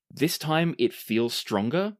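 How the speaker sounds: ordinary talk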